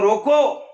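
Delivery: loud commanding रोको, then a stop; रोको called out firmly and set apart; pause after रोको. Shown as a demonstration. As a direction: order someone with a forceful रोको and halt. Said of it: "फिर रुका".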